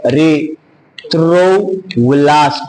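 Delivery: extremely slow "erythroblast", every syllable stretched apart; teacher dragging out "erythroblast" as a demo; show the word. Shown as a demonstration. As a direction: spell out "erythroblast" by sound for students to copy. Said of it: "ये देखो".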